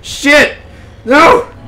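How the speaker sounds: loud, high, frantic cries; panicked yelling. Real, not acted. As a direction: yell in panic as it comes for you. Panicking at attacking creature.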